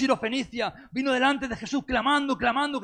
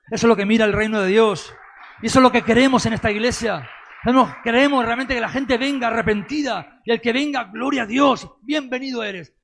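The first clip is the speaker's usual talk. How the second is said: loud, rousing pulpit proclamation; impassioned, shouted sermon climax; full-force preaching; 3 semitones higher, 8 dB louder than usual. Real, not acted. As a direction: drive it home to the whole congregation at full volume.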